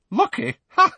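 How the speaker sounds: put-on high, scoffing young woman; young witch. A narrator's reading. Witch scoffing at Harry's idea of luck, high voice. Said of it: "said the violet-haired woman".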